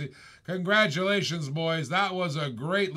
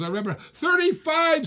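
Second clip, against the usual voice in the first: higher, louder hammed-up shout of the bid; mock bidder shout; 10 semitones higher, 3 dB louder than usual. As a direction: yell the bid like a cocky rival. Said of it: "says Kubrick".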